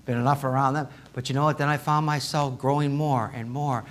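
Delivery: normal talking voice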